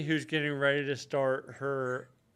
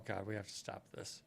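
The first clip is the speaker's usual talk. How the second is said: whispered, fighting laughter; 6 semitones lower, 15 dB quieter than usual. Real, not acted. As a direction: hush it out, trying not to laugh. Whispered, holding back laughter.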